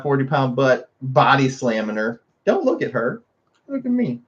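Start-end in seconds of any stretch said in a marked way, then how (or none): 2.4-4.2 soft pet talk. Real, not acted. Softer, higher pet talk.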